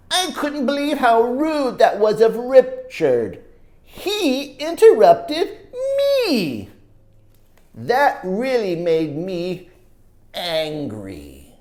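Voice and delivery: put-on lively read-aloud for kids; storybook narration. A narrator's reading. Reading a story to children, animated narration. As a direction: tell it like a picture book to children. Reads picture book aloud.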